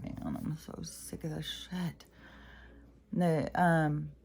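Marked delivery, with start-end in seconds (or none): 0.0-2.1 fed-up whisper. Real, not acted. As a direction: breathe it out, exasperated. Fed up, muttered under breath.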